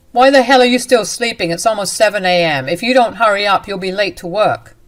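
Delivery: loud, harsh demand from the husband; angry shout. Acted. Husband yelling at his exhausted wife to get up, angry shout. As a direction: bark it at her like a furious husband. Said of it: "He said".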